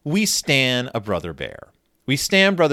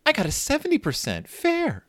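stunned; 8 semitones higher than usual. Retold or imitated. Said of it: "Sister is stunned".

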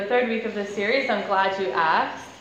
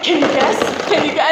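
louder, teasing repeat; giddy kid brother; 4 semitones higher, 6 dB louder than usual. Retold or imitated. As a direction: bounce the question at her. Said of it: "Just, and".

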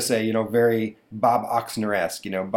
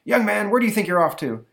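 higher, louder mock-stern father; stern dad; 11 semitones higher, 5 dB louder than usual. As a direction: catch the boy by the collar. Dad catches son.

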